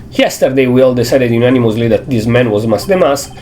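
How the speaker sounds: normal talking voice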